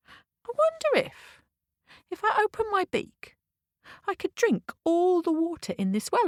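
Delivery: high, musing cockerel voice; cockerel wondering aloud. Acted. Cockerel tumbling down the well, wondering. Falling cockerel has an idea.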